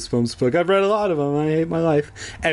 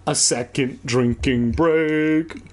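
slow announcer read; mock announcement; slower than usual. Shown as a demonstration. Reader announcing a drinking break, slow announcer read. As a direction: announce it slowly like a title card. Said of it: "announcer-style aside".

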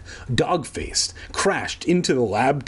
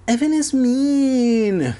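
put-on high, drawn-out childish whine; whiny; 10 semitones higher, 4 dB louder, slower than usual. A narrator's reading. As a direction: whine slowly in a high crybaby voice. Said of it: "like a little bitch".

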